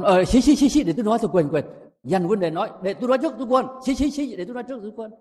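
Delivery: high-pitched mock chatterbox; over-eager interrupter. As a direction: play someone butting in, high and excited. Acts out over-eager person cutting in. (bắt chước người tranh nói, giọng cao)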